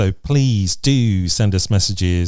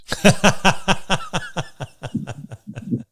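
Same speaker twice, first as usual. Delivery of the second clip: laugh; 9 semitones higher, 3 dB quieter than usual. Real, not acted. Host laughs at cohost's joke.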